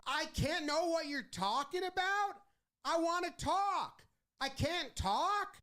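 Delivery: high, indignant complaining; mock outraged why; whiny manager protest. Manager voiced complaining to umpires.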